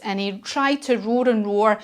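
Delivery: usual speaking voice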